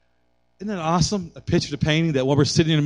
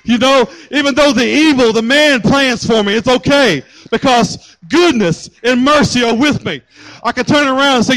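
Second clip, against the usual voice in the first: loud, high preaching climax; preaching at full force; 9 semitones higher, 7 dB louder than usual. Acted. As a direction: proclaim it triumphantly to the congregation. Climax of sermon.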